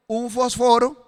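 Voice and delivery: "fósforo" stressed as fosFÓro; accent the middle syllable of "fósforo", left hanging upward; Greek pronunciation. Shown as a demonstration. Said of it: "estoy hablando en griego". ("fósforo" a la griega, con acento en la segunda o)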